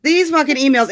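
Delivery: fed up, venting; exasperated. Real, not acted. Exasperated at reading emails.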